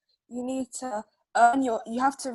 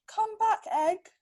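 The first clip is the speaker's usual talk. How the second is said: higher call after the egg; chicken calling; 9 semitones higher than usual. Acted. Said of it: "chicken calls".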